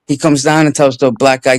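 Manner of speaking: ordinary delivery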